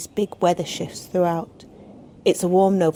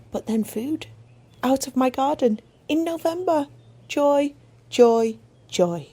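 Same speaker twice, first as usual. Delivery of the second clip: higher, rising wonder at an unexpected find; delighted surprise; 6 semitones higher than usual. Real, not acted. Gardener marvelling at potatoes found in November, delighted. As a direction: let the joy lift your voice high.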